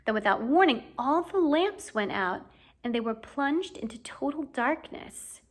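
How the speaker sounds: plain reading voice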